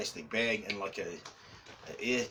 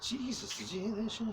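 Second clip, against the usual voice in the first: startled; 10 semitones higher than usual. Real, not acted. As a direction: react to a sudden noise, taken aback. Startled by a sudden noise.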